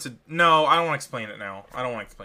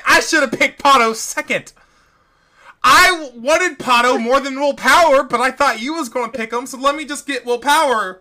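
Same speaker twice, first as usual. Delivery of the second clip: louder, higher, exasperated complaining; frustrated rant; 6 semitones higher, 6 dB louder than usual. Real, not acted. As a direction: vent about a bad pick. Friend annoyed by lost picks.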